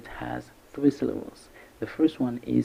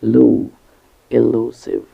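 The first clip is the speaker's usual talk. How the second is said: "lu" loud alone, then elusive stressed on "lu"; stress on second syllable; 8 dB louder, slower than usual. Shown as a demonstration. Teacher showing learners where elusive is stressed, loud stress on "lu". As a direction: punch "lu" in elusive. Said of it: "The stress is on the second syllable".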